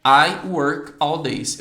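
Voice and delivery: very slow, loud I work all days; teacher drilling the correct sentence; slow model sentence. Shown as a demonstration. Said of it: "eu teria que falar assim".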